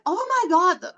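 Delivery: high, shocked gasp of fright; startled. Retold or imitated. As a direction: jolt up in alarm as the scissors slip. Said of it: "It was so scary that I'm like".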